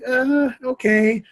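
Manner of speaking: long, high-pitched uh of disbelief; acted sceptical hesitation; mock doubtful okay. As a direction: draw it out slowly, pitched high, unconvinced. Acted doubtful reply to excuse.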